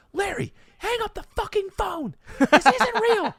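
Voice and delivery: high, loud yell at dad; mom yelling. Retold or imitated. Mom yelling at dad to hang up the phone. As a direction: scream it from the background. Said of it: "I can hear my mom in the background going".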